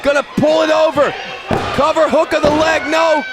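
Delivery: excited ringside play-by-play; wrestling commentator. Acted. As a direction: call the action live. Live wrestling play-by-play call.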